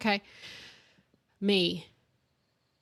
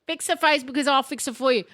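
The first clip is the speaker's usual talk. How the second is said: imitating stern mum; 6 semitones higher than usual. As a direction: snap the threat like an angry mum. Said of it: "And then it".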